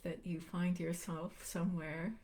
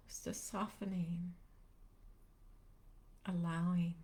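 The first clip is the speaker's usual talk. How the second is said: unhurried meditation guide, long pauses between phrases; calm, spacious guiding voice; guided meditation; 5 dB quieter than usual. Real, not acted. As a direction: gently lead listeners into stillness, leaving space after each phrase.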